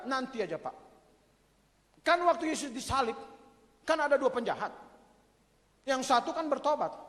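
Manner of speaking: mock excuse. Acted sinner excusing himself.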